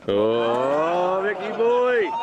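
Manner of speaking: long rising swoop on the "Oh"; playful drawn-out jeer from the crowd; teasing heckle. Real, not acted. Heckles the speech maker, teasing.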